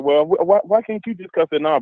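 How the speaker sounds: playing the woman who wants him to deal with it; mimicking her. Imitating the woman pushing back.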